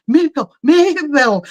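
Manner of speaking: mock reproving husband; retold cautioning. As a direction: imitate a husband reining in his wife. Husband cautioning her by name.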